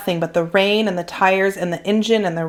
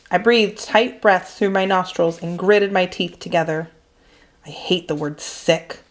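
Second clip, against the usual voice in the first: ordinary delivery, nothing marked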